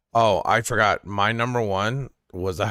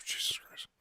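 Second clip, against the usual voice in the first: whispered; 13 dB quieter than usual. Real, not acted. Exasperated at own fumble.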